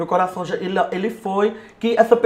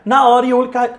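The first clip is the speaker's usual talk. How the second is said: ordinary talk, nothing marked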